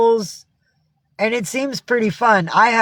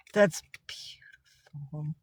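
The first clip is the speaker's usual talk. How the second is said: soft, slow whisper; 3 semitones lower, 12 dB quieter, slower than usual. Real, not acted. To Diane about the puppies, an awed whisper. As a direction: breathe it out in awe. Reacting to baby chihuahuas.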